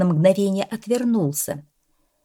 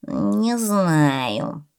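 doubtful. A narrator's reading. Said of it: "вновь засомневался Чёрный".

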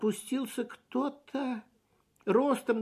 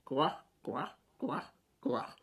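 hen clucking; 5 semitones lower, 4 dB quieter, slower than usual. A narrator's reading.

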